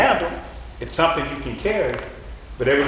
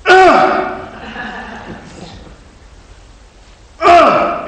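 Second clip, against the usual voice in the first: high, loud grunt of effort; straining grunt; 6 dB louder than usual. Retold or imitated. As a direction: grunt as the bar goes up. Imitating straining lift.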